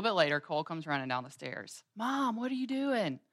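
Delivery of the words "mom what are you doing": higher, lightly voiced young son asking; little boy's voice. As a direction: ask like a curious little boy. Son Cole runs downstairs, voiced.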